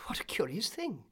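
a touch of surprise; puzzled cry. A narrator's reading. Swallow hit by a drop of water, mildly startled cry. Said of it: "he cried".

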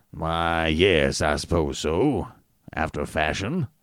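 a hint of a reluctant old physician; lightly voiced grudging doctor. A narrator's reading. Doctor answers reluctantly, lower voice.

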